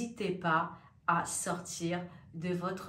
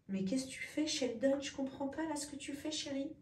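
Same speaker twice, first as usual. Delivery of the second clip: soft aside to cat; 4 semitones higher, 7 dB quieter than usual. Real, not acted. Softly talking to her cat.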